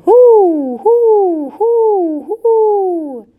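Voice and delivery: very high, loud, long hoots; eerie owl hoot. A narrator's reading. Owl calls from the tree, eerie hooting. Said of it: "an eerie, wavering call".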